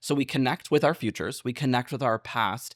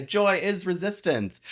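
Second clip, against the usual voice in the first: mocking quote; 6 semitones higher than usual. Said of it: "It grinds my gears when everyone loves to say".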